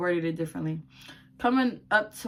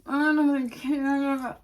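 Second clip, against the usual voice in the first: bored dismissive mock; 7 semitones higher, 3 dB louder, much slower than usual. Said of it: "and a bitch like".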